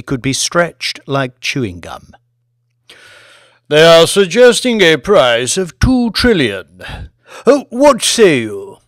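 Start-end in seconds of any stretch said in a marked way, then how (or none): 3.7-8.8 put-on old aristocrat, relishing a bold deal; old Lord Martin. A narrator's reading. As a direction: savour the huge number like a grand old man. Old lord names the price.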